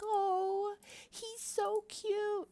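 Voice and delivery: high, slow, cooing over a cute cat; gushing viewer. Retold or imitated. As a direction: imitate a fan melting at the kitten. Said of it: "You'd be like".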